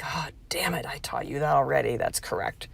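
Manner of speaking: hushed, caught-out whisper. Retold or imitated. Mom whispering to herself after her son calls out her sarcasm. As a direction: breathe it out under your breath, busted.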